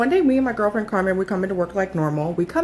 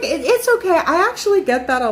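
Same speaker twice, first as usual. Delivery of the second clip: a higher, gentle imitation of the old lady; sweet old lady; 8 semitones higher than usual. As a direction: kindly reassure them as the old lady. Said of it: "this lady was so sweet".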